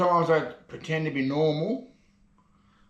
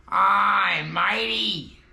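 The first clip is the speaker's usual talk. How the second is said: lightly voiced pirate with drawn-out vowels; a hint of a hearty pirate hail; 4 semitones higher, much slower than usual. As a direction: raise the pitch for a jokey pirate punchline. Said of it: "What'd the pirate say".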